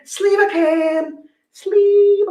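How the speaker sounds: high hype voice. Acted. Hyping the product cam.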